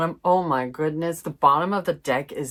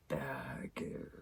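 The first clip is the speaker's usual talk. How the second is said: breathy whisper on the word; hushed ominous whisper; 18 dB quieter than usual. Acted. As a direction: drop to a secret whisper. Whispered word in tarot reading.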